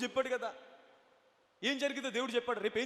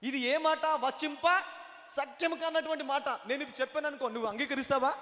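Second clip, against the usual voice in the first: raised, emphatic pulpit challenge; ringing sermon questions; full-force preaching; 4 semitones higher, 6 dB louder than usual. Real, not acted. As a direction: challenge the congregation in a high preaching voice.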